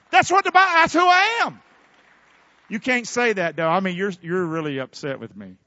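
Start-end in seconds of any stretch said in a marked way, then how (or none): 0.1-1.6 loud, high outburst; emphatic shout. Real, not acted. Preacher defending his blunt talk, emphatic shout.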